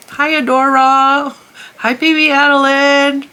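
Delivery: high, loud, drawn-out hello. Real, not acted. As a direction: sing out a greeting to toddlers. Greeting little nieces on camera.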